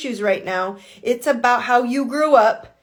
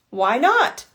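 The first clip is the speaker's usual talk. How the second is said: ordinary talk, nothing marked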